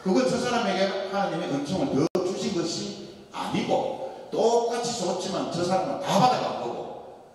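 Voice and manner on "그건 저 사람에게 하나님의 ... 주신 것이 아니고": ordinary talk, nothing marked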